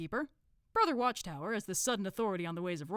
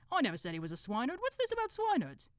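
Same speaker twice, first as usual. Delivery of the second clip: baffled protest, jumping higher on the question; Brother Watchtower; 8 semitones higher, 3 dB louder than usual. Acted. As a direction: object quickly, then question it.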